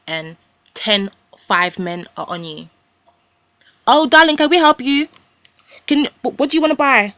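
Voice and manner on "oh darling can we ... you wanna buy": loud, high overeager sales greeting; mimicking pushy shopkeepers; pushy shop assistant. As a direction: pounce on the customer, loud and high. Acting out pushy shop men.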